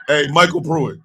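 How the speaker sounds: usual speaking voice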